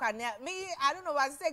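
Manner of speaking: ordinary talk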